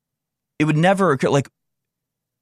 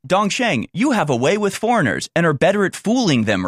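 higher, sneering retelling of the order; mocking the Chinese official; 6 semitones higher than usual. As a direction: mimic the boss, a touch higher. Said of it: "This is how they talk".

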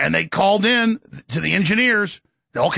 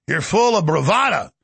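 usual speaking voice

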